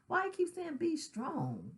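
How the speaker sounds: a high exasperated why, near tears; retold grieving son questioning his father in a high voice; frustrated inner cry. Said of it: "And I would say to myself".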